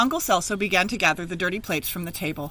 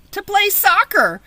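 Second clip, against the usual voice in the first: excited idea; 12 semitones higher, 8 dB louder than usual. Acted. Sudden idea at the party.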